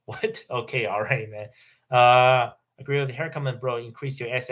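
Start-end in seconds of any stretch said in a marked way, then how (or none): 0.1-0.4 surprised laugh. Real, not acted. Laughs at a rude super chat.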